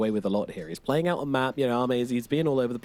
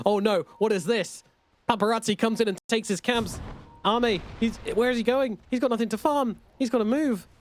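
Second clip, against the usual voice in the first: high-pitched, alarmed commentary; excited play-by-play; 11 semitones higher than usual. Real, not acted. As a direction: call it as a sudden turn in the match. Commentator reacts to sudden play.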